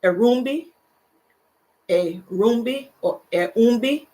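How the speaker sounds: tentative go at Erumbi; careful name tryout. Real, not acted. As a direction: try Erumbi carefully, unsure of it. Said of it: "I don't wanna mess up your name".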